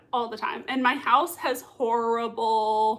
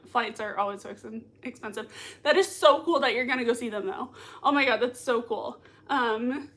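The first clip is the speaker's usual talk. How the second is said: usual speaking voice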